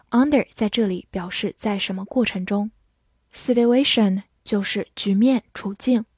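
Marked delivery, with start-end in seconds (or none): none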